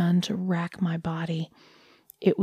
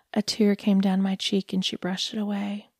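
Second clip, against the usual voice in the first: ordinary delivery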